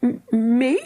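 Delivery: high, startled stammer; girl stammering when blamed. Acted. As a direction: catch on the first sound, caught off guard. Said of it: "stammered Philly".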